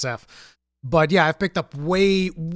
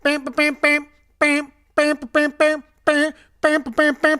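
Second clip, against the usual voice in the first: rhythmic bam bam horn honks; imitated forklift horn; 10 semitones higher, 4 dB louder than usual. To chat, copying the forklift guy's honking, high rhythmic bams. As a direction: mimic the horn beating nonstop. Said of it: "literally driving around going".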